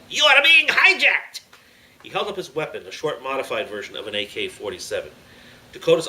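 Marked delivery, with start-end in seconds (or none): 0.1-1.4 high, loud, drawn-out villain shout; terrorist's high shouted threat; sneering yell. A narrator's reading. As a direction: yell it slowly at full force. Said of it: "sneered at Dakota and yelled".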